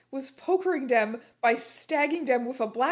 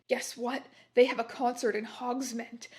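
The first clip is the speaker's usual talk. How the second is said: ordinary delivery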